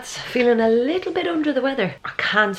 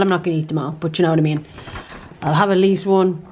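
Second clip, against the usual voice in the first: ordinary talk, nothing marked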